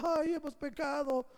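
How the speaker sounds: mock wail of regret; mock whining. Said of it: "de lágrimas chillando".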